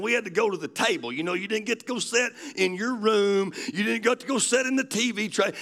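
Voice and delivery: usual speaking voice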